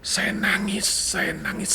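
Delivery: high, hushed, choked-up repetition; quiet, high and close to crying; emotional, near tears. Retold or imitated. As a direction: hold back tears softly. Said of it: "saya nangis kayak anak kecil".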